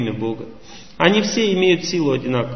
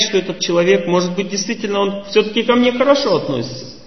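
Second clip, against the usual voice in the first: ordinary talk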